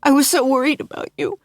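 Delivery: high, fast frightened rush; Hannah, shaken. Acted. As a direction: tumble out the fear quickly.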